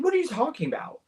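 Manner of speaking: high, quick incredulous question; retold bafflement. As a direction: imitate someone totally lost. Retold girlfriend's baffled reply.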